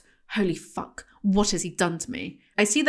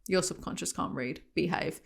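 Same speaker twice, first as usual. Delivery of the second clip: much softer, lower muttered aside; quiet aside; 3 semitones lower, 11 dB quieter than usual. Real, not acted. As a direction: mumble it under your breath.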